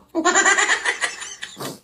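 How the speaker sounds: short high giggle; laugh. Real, not acted. Streamer giggling about her Christmas voice. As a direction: giggle at your own boast.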